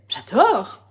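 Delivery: J'ADORE popped out with a quick rise and fall; delighted exclamation. Real, not acted. Crafter admiring her card to viewers, delighted exclamation.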